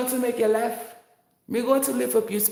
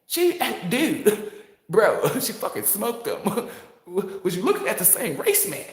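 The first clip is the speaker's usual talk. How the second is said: Y T voice; 6 semitones higher than usual. Pretend. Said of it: "put your Y T voice on it".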